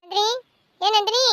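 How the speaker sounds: loud rising call. Acted. Sister waking Nandini, loud calling. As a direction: call the name loudly to wake her.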